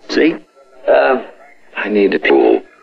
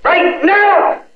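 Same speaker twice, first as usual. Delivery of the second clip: very high yelled demand; urgent demand. Pretend. Caller demanding a swim, urgent high yell. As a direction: yell it as an order.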